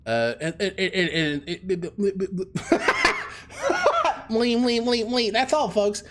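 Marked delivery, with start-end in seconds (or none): 1.9-4.3 helpless high-pitched laughter; cracking up; high laughing fit. Real, not acted. 4.3-6.0 cartoon sign-off.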